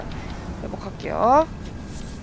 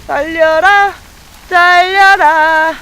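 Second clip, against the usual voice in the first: high, loud, drawn-out battle call; urging shout; 5 semitones higher, 7 dB louder, much slower than usual. Real, not acted. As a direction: shout it out and stretch the second one. Urging a run in the game.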